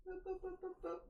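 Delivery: quick string of high boops; scanning boops. Real, not acted. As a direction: boop along in a high voice while checking. Scanning sound while checking for accents.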